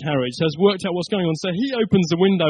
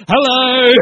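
a loud, pitched-up, startled hello; mock startled hello; 7 semitones higher, 11 dB louder than usual. Passengers reacting to a stranger threatening to bite, a loud startled hello. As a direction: blurt it out like a shocked commuter.

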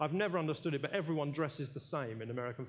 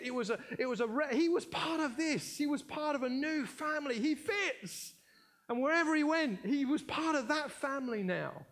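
excited; 9 semitones higher than usual. Real, not acted. Elated preaching at full force.

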